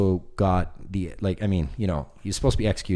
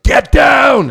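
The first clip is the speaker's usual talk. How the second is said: sudden yelled command, much higher and louder; guards shouting; 15 dB louder than usual. Retold or imitated. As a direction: bark it like a prison guard. Guards shouting in prison riot.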